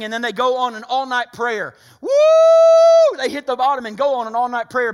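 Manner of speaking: loud, high, driving preacher cry; full-force preaching. Acted. Preacher crying out to his church at full force. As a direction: lift the room with it.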